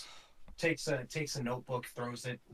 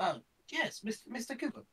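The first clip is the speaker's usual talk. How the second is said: high put-on old man voice; a first try at the old scholar's voice; 11 semitones higher than usual. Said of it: "No, that's not it".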